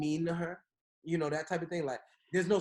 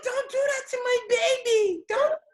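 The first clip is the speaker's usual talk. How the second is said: high, loud, tearful mock wail; wife's crying plea; 8 dB louder than usual. Husband mimicking his wife defending the baby, high mock wail. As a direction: beg for the baby in a whiny cry. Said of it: "She like".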